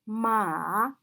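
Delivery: scoop "ma" down and up; drawn-out falling-rising "ma"; third tone. Shown as a demonstration. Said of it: "when we indicate the four tones".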